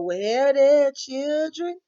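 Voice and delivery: loud, high, slow Southern drawl. Retold or imitated. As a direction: stretch out Southern drawl like an old preacher woman. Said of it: "her talking in a Southern drawl".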